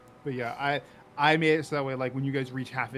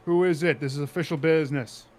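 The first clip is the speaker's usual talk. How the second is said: calling out sternly; 3 semitones higher, 8 dB louder than usual. Acted. Official challenging whoever knocks at the door, calling out sternly. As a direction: call out, loud and official.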